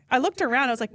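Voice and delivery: ordinary talk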